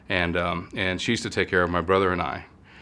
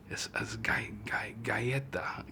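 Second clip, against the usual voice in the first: whispered; 3 semitones higher, 11 dB quieter, slower than usual. Retold or imitated. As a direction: whisper "galleta" slowly, syllable by syllable. Secretly sounding out Spanish word.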